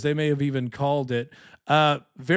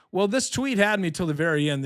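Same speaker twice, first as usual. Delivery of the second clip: ordinary delivery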